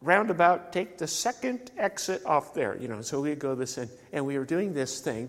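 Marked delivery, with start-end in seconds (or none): 0.0-2.8 British GPS lady. Retold or imitated. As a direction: lightly imitate a polite British GPS lady announcing the turn. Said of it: "lady's voice in a British accent".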